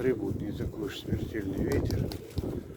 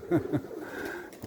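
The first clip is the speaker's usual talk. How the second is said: breathy laugh; 5 semitones higher, 7 dB quieter than usual. Real, not acted. Laughs at pigeon.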